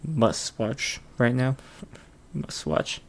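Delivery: ordinary talk, nothing marked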